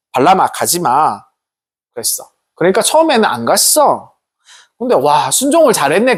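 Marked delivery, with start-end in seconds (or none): 0.1-1.3 slow, lower, gentle command; God's voice. Acted. God tells Balaam not to go, slow and low. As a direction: speak as God forbidding calmly. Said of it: "하나님께서 뭐라고 그러셨냐면".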